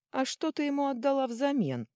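high, loud mocking question; snide taunt. A narrator's reading. Companion taunts girl about the bargain, high snide jibe. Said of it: "съязвил Фарки".

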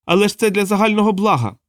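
very high, pleading wail; high-pitched frantic excuse; Edward crying out. A narrator's reading. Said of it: "волав Едвард".

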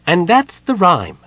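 proud little Kender announcing. A narrator's reading. Tass finishes teaching Parlin the rhyme, high and proud. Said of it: "said Tasselhoff".

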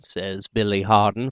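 plain reading voice

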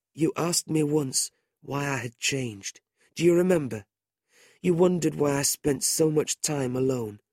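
lightly voiced frail boy, tender confession; a hint of a frail, earnest youth; weak dying boy. A narrator's reading.

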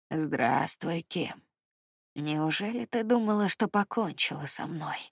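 low, quiet sinister creature; menacing monster voice. A narrator's reading. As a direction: purr it out like a predator. Monster greets terrified Kim.

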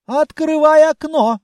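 loud barked order; commanding shout. Acted. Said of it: "скомандовал".

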